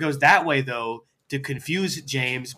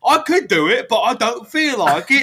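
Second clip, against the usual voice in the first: high, loud British whine; hammed-up Brit making excuses, pitched up; mock British accent; 9 semitones higher, 7 dB louder than usual. Carlisle pushes the job onto Edward, mock British accent. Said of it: "British people".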